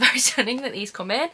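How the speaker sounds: usual speaking voice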